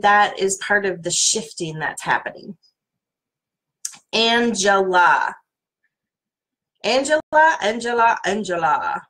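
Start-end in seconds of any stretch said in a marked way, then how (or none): 4.1-5.4 Angela said slow and a bit louder; slow sounding of the name Angela; drawn out. Shown as a demonstration. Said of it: "in all different kinds of voices". 6.8-7.6 Angela said higher and louder; bright high call of Angela; higher pitched. Shown as a demonstration. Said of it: "in all different kinds of voices".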